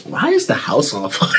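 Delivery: high unvoiced squeak; squeaky confused question. Confused son asking mom why the house burns, high squeak. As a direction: ask it in a tiny airy squeak. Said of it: "I go".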